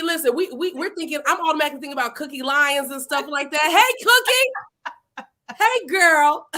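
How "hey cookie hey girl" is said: much higher, very slow sing-song hey; playful drawn-out greeting. Real, not acted. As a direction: stretch the hello out teasingly. Playful greeting to cousin Cookie.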